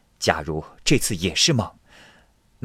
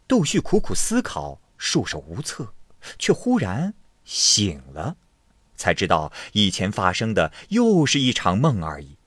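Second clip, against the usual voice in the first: ordinary delivery, nothing marked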